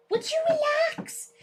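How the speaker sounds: high voice to dog. Real, not acted. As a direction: talk up high at the pet.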